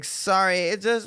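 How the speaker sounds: put-on high apologetic drunk whine; whiny drunk woman. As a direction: play her as a sorry drunk. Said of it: "she's like".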